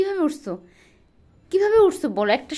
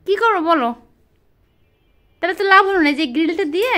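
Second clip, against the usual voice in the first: usual speaking voice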